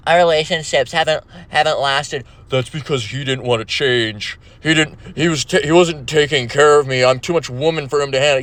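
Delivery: lightly voiced woman making excuses; mock complaining woman. Woman explaining why her relationships haven't lasted, mock complaint. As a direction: imitate a woman blaming her ex.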